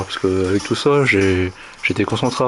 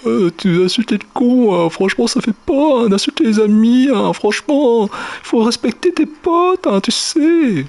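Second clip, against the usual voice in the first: whiny crybaby voice; 13 semitones higher than usual. Retold or imitated. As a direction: whine it like a sulking crybaby. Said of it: "il faisait sa pleureuse".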